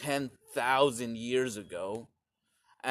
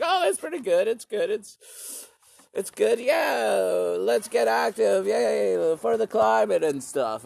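put-on much higher, perky girl voice; lightly mocking upbeat high voice; girl's cheery reply; 9 semitones higher, 5 dB louder than usual. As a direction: chirp it back like an eager activist. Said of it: "And she's like".

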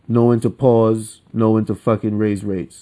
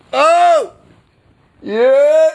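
mock crowd cheer; 6 dB louder than usual. Mocking cheering crowd out there.

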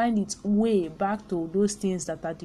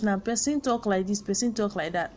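normal talking voice

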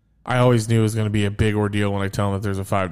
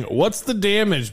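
high, slow mock dad line; 9 semitones higher, slower than usual. Dad to cashier, high slow mock dad voice. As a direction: drawl it out like a dad eyeing a bill. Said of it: "dads love saying".

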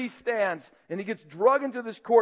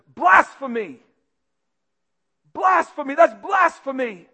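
higher and much louder cry of outrage; outraged shout; 5 semitones higher, 6 dB louder than usual. Acted. High priest condemning Jesus, outraged shout. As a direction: shout it like a verdict. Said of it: "this powerful word".

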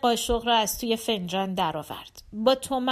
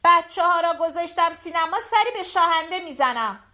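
raised, higher-pitched call from the hallway; mild shout; 7 semitones higher, 3 dB louder than usual. Acted. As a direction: call out the news to another room. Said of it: "از راهرو که داد زد". (با صدای کمی بلند، از راهرو)

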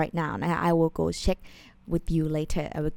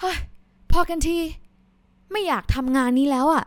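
higher, louder, fast exasperated quitting line; fed-up outburst; 8 semitones higher, 3 dB louder, much faster than usual. Shown as a demonstration. Worker quitting a job they can't stand, fed-up outburst. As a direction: quit the job in frustration. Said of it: "you can say".

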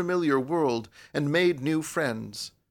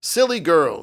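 loud, raised-pitch impatient retort; heated; 8 semitones higher, 8 dB louder than usual. A narrator's reading. Young man and girl quarrelling over money, heated. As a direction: burst out impatiently, high and loud.

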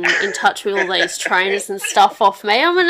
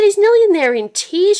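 higher, louder put-on Mum voice; mock Mum; 13 semitones higher, 6 dB louder than usual. Daughter retelling Mum's worry about Dad, mock Mum voice. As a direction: play Mum, a bit scandalised.

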